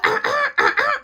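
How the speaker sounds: high, loud mimicked throat noises; exaggerated throat clearing. Imitating friends who want his attention, loud throat clearing. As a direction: clear the throat pointedly and loudly. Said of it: "clearing their throat to get my attention".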